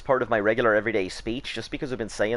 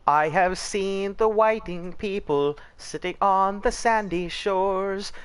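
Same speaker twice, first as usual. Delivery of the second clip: high, slow mock-poetic narration; dramatic recitation; 11 semitones higher, 3 dB louder, much slower than usual. Mocking pale beachgoers, he recites a slow, high ode.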